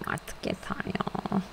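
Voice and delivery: breathy exasperated whisper. Real, not acted. Player fed up with the dithering Sims, whispered sigh.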